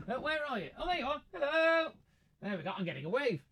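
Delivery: soft, high little hello away from the mic; high, hushed sing-out to someone waving; high-pitched distant greeting. Real, not acted. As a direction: greet someone you have just spotted, high and quiet.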